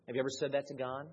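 ordinary delivery

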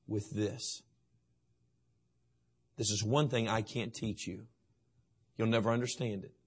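low, slow, soft fatherly plea; tender father. Acted. Father tenderly asking his son for his heart, slow and soft. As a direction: gently ask for his son's heart. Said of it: "Dad's saying?".

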